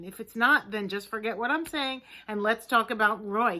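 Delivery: ordinary delivery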